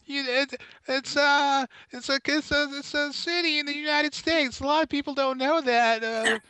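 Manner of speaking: very high, halting comic voice; hammed-up bluffer. Stalling for a Boston fact, hammed-up.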